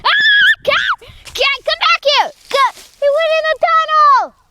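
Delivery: high, loud panicked yelling after a runaway cat; panicked shout. Real, not acted. Kid yelling after the cat as it vanishes into a tunnel, panicked high shouting.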